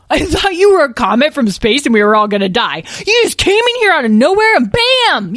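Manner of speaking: put-on high, loud, over-excited kid voice; awestruck young dragling. Dragling tells his hero about the amazing crash, wildly excited. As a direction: gush at full volume, thrilled by your hero.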